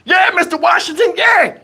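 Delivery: high-pitched imitated hype crowd; mock cheering from onlookers; hyping him up. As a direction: egg the old man on, high and eager. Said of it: "they getting him hyped".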